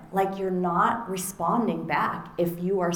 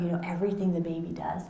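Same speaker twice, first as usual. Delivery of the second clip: ordinary talk, nothing marked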